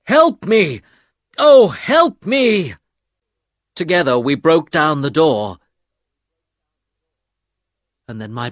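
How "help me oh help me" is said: louder, high, desperate call, slowing at the end; calling for help. A narrator's reading. Husband calling out to his friends, desperate loud call. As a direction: shout to friends downstairs, high. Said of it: "I called out to my friends".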